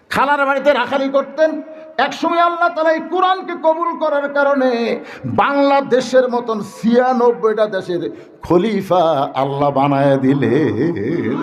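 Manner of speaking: high chant settling into a long quaver; melodic preaching. Real, not acted. Preacher tells how Allah made Omar caliph, melodic preaching. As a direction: sing out the story, stretching the last word. Chanted sermon climax, falling ending.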